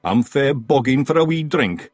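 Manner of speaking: loud, high Scottish pixie; cheeky wee Scot shouting up; Scottish Feegle. Acted. Big Yan.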